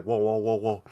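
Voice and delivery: low, soft run of whoas; acting out a wave through the body; body reeling. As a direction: let the whoas roll out low and hushed. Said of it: "my body was just like".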